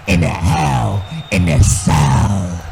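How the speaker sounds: slow, low, leaned-on threat; a growled promo closer; menacing threat. Acted. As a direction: drag out the final promise of pain. Threat closing wrestling promo.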